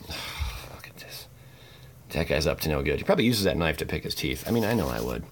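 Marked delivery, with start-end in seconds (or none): 0.1-1.3 whisper. Acted. Whispered at a card.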